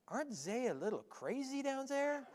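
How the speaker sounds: mock a neighbor's German accent, quietly doubtful. Neighbor questioning the move to North Carolina, German accent. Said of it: "he's got this German accent".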